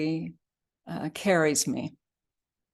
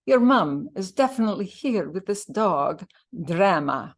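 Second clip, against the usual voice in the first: louder, lively Irish nurse voice; Irish accent with flat A's; Irish brogue; 3 semitones higher, 5 dB louder than usual. Acted. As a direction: declare it with an Irish lilt. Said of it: "Her brogue made it, made her A's flat".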